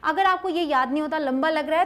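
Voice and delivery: ordinary delivery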